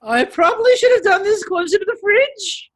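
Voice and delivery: high, loud imitation of a contestant; mock anxious contestant. Acted quote of struggling contestant.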